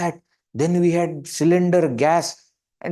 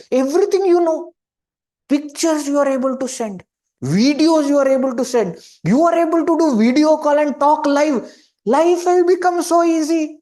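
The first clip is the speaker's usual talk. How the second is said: excited marvel; 9 semitones higher than usual. Real, not acted. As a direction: build enthusiasm listing each marvel. Marvels at modern conveniences.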